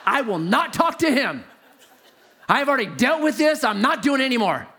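retold indignant stand; defiant refusal. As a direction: dig in and refuse, pitch climbing. Arguing back at the voice.

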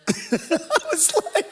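high, laughing voice; tickled, words squeezed out by a laugh; cracking up. Real, not acted. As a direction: talk through real laughter. Laughing at the bumper sticker joke.

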